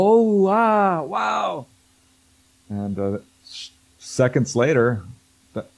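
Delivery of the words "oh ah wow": high breathy-free gasping wonder, imitated; group awe. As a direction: mimic a crowd staring up in awe. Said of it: "And they all go".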